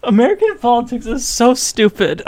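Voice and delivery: high laughing words; crying with laughter. Real, not acted. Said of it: "I'm crying".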